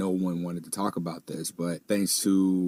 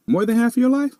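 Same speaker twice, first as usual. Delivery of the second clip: high, loud amazed question; genuinely taken aback, voice jumping high; surprised; 9 dB louder than usual. Real, not acted. Surprised at guest's years.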